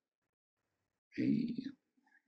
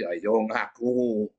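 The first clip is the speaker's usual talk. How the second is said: very slow, drawn-out demand to confess; mock demand; 3 semitones lower, much slower than usual. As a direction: stretch it out like pressing for a confession.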